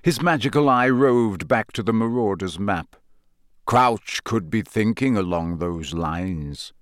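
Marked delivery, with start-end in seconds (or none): 3.7-6.7 slow, measured and musing; slowly. A narrator's reading. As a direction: draw the words out thoughtfully. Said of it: "he said slowly".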